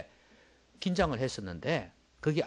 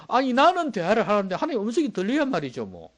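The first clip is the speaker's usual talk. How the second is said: complaining; 8 semitones higher, 7 dB louder than usual. Retold or imitated. Believer complaining God is silent.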